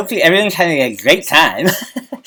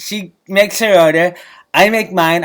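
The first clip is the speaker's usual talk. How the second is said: usual speaking voice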